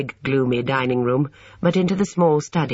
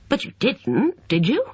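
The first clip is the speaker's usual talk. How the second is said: slightly shocked; 10 semitones higher than usual. A narrator's reading. Brian asks Lucy if she did it, mildly shocked. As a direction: let a little shock slip into the question. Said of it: "He sounded slightly shocked".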